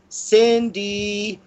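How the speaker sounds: put-on high singsong child voice; little girl counting in high singsong; sing-songy little girl. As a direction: call it in a lilting, playful chant. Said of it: "in a little sing-songy voice".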